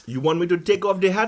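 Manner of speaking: insistent put-on Jamaican patois; fake Jamaican accent. Guest defending his herb to the host, fake Jamaican accent. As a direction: play a protesting Jamaican, broad accent. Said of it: "this is not your real accent".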